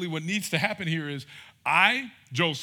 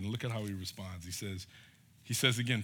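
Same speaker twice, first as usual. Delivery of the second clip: normal talking voice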